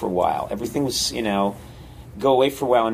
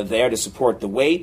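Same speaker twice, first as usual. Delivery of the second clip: ordinary delivery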